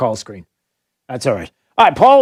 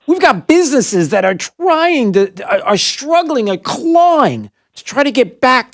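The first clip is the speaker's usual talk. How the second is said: rising indignation, higher pitched; worked up; 7 semitones higher than usual. Real, not acted. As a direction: build the outrage toward the question. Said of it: "I'm, I'm worked up".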